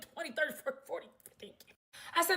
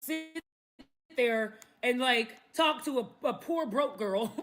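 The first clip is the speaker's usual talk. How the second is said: ordinary talk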